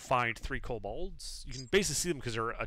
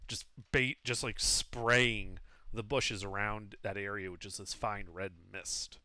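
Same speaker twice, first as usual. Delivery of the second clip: ordinary talk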